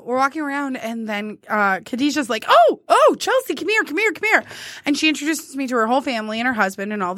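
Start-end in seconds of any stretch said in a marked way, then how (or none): 2.5-4.4 excited calling over. Retold or imitated. Coworker calling her over.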